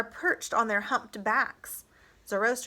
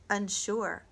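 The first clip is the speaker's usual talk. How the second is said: plain reading voice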